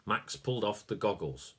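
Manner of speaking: plain reading voice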